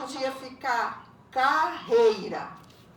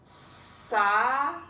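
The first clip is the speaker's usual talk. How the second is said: "Salame" very slow, Sa... la... me; split into syllables; 4 dB louder than usual. Shown as a demonstration. Teacher answering which has three syllables, "Salame" as Sa... la... me. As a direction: leave a gap after each beat of "Salame". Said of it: "Qual que tem três sílabas".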